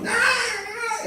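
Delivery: a short high wail like a newborn; mock baby wail. Pastor imitating a newborn's cry, high wail. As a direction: cry out like a baby just born.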